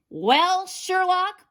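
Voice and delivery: loud, high booming granny. A narrator's reading. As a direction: boom it out in triumph. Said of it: "boomed the farmer".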